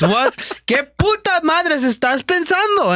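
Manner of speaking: very high, drawn-out Spanish yell; angry mom imitation. Mom switching to Spanish at son, angry yelling imitation. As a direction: explode into a high-pitched scolding. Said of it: "yelling at you in Spanish".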